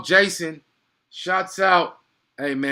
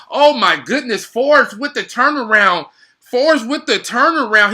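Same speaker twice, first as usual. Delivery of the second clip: loud, higher hype call; excited call; 7 semitones higher, 10 dB louder than usual. Real, not acted. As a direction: yell the highlight. Live play-by-play excitement.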